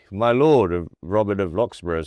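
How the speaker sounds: a touch of loud theatrical declaiming; booming stage actor. Retold or imitated. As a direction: project it like a stage lord. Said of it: "I arrived kind of talking like this".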